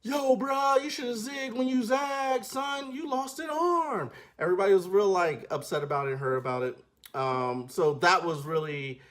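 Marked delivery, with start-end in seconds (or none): 0.0-4.1 high teasing voice, slower at the end; mocking onlooker. Crowd teasing the one-armed soldier, high mocking voice. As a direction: rib him like a cocky bystander. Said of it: "everybody's looking at him like".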